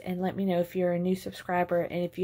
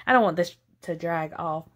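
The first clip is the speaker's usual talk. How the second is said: ordinary delivery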